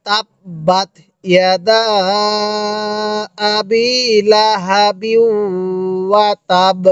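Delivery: slow, measured recitation with held long vowels; drawn-out teaching recitation; model Quran recitation. Shown as a demonstration. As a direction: recite slowly for a listening student, phrase by phrase. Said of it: "এবার আমি একবার পড়ব, আপনি শুধু শুনবেন".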